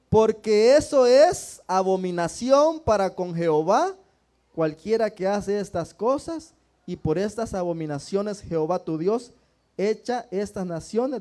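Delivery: loud, measured Bible reading from the pulpit; scripture reading. Acted. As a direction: proclaim each verse to the whole church. Reads scripture aloud to the congregation. (lectura bíblica en voz alta y solemne)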